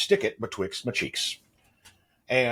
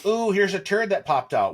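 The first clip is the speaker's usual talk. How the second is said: high, louder mock-cheery voice; mock cheerful; 11 semitones higher, 4 dB louder than usual. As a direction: make it sound chirpy and trivial. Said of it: "it's not like".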